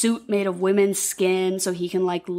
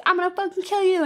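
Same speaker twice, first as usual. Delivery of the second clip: high, raised threat; mock threat; 9 semitones higher, faster than usual. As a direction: yell it at him in mock fury. Clarice threatens Buffalo Bill.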